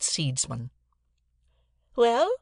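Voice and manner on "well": high, loud demand; sharp cry. A narrator's reading. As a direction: pounce on it as a challenge. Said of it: "cried my sister".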